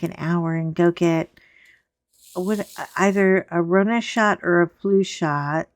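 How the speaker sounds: normal talking voice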